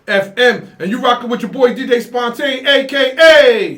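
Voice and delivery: big hype DJ announcement; radio announcer. Real, not acted. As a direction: stretch the A K A like a DJ drop. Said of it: "DJ announcing his name".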